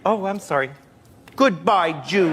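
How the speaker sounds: lightly voiced child, half-hearted anger; a touch firmer, still harmless; little girl attempting anger. Child actress retries for the director, feebly angry. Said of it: "we need a little more anger".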